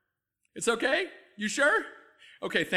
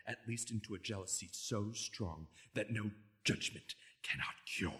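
low, quiet menacing Shakespeare; brooding Iago; 10 semitones lower, 7 dB quieter than usual. Acted. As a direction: whisper Iago's vengeance into the dark. Suddenly switches into Iago speech.

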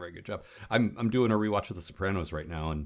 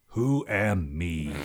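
very slow, dim Bizarro question; 4 dB louder, much slower than usual. Retold or imitated. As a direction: drawl it out like a confused Bizarro. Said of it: "him talking to, uh, Brainiac going".

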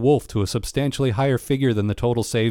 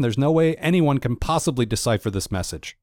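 normal talking voice

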